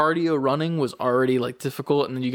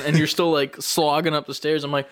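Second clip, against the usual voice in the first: ordinary talk, nothing marked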